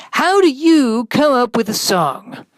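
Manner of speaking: high, loud, bright-voiced radio host. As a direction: pump up the show like a cheesy DJ.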